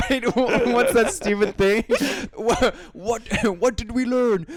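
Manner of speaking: high, laughing disbelief. Real, not acted. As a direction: laugh through the question.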